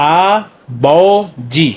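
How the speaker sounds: "आ बो जी" very slow, three held syllables. Shown as a demonstration. Teacher joining three syllables, "आ बो जी" slowly separated. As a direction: sound out "आ", "बो", "जी" with gaps. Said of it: "तो क्या बन गया".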